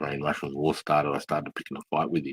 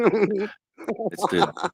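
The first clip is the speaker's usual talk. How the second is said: much higher, slow, put-on voice; a silly high-pitched agreement; squeaky high mock voice; much slower than usual.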